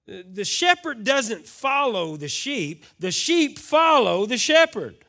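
loud, high preacher's cry; fiery preaching. Acted. Pastor preaching to the church, loud and fervent. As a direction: drive the point home to the congregation.

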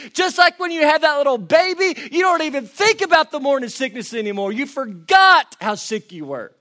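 high and loud, slowing on the last line; sustained sermon shout; full-force preaching. Acted. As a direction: keep preaching it hard.

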